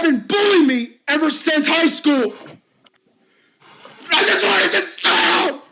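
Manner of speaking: high, strained ranting with bursts of shouting; distraught bullied man. Acted. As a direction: vent every hurt in one rush. Finn pours out his pain.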